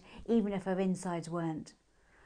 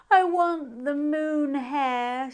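put-on drowsy child; 7 semitones higher, 7 dB louder, much slower than usual. A narrator's reading. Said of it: "as her eyelids drooped".

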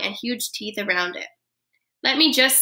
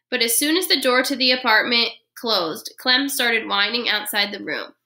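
ordinary delivery

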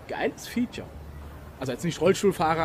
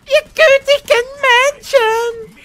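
much higher, loud wailing plea; bard's wailing cry; 15 dB louder, much slower than usual. Retold or imitated. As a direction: belt it like a desperate minstrel. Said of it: "Das musst du auch, auch ganz machen".

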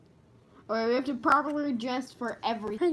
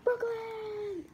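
high squeal; 11 semitones higher than usual. Acted. Echo high.

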